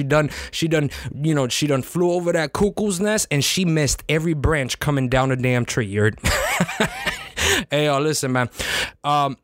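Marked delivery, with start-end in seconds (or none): 6.2-7.6 high squealing laugh breaking out; burst of laughter. Real, not acted. Host laughs at his own cuckoo's nest line. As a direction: crack up at his own joke.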